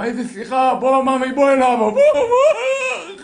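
high, loud, building into a drawn-out wail; hammed-up tearful calling to his boy; overcome father. Relieved father embraces crying son. (אב נרגש, יבבה קומית מוגזמת)

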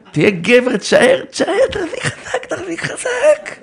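high coaxing squeak, slow at the end; mock coaxing. Preacher coaxing the husband to hold on, in a high mock voice. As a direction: squeak it like a cheerleader.

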